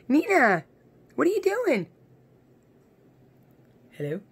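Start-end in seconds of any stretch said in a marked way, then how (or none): 0.1-1.9 very high, loud pet call; excited calling. Real, not acted. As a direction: squeal her name to get her attention. Calling the dog again.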